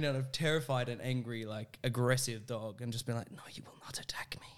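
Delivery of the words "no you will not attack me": low-key whisper to stare down a dog; whispered command. Retold or imitated. Facing down an angry dog, tense whisper. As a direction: whisper it like a standoff.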